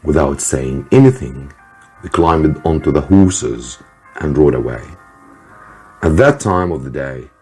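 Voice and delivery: usual speaking voice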